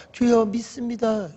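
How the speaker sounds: high, soft, slow devout imitation; mock piety. Believer only inside the temple, mock piety. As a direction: draw it out in put-on devotion.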